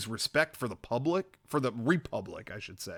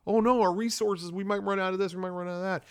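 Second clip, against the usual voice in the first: mock whiny senator; 6 semitones higher, faster than usual. Mocking greedy senator Tavetti.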